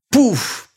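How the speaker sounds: high sharp pouf; puff sound effect. A narrator's reading. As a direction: pop it like smoke.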